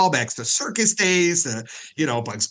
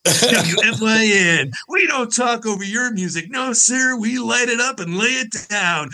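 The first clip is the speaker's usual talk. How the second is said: hyped station promo, pitched up; rolling, higher-pitched DJ patter; bragging radio DJ; 5 semitones higher than usual. Retold or imitated. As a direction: parody a boastful music-radio announcer. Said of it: "where they're bragging about how they play music".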